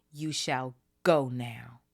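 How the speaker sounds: slow, breathless threatening whisper; fierce whisper. Acted. Dobby ordering Malfoy away, slow fierce whisper. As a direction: hiss the order low and slow. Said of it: "he said, fiercely".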